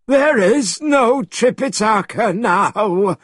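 high, slow shouted reply; shouted back. A narrator's reading. Pigsy answering Monkey in the air, shouted back. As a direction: call it back, drawn out and high. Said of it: "Pigsy shouted back".

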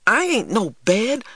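a sudden cry as hands land on you; high, startled outburst; startled exclamation. A narrator's reading. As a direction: jump up high on the words, startled. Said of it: "I exclaimed".

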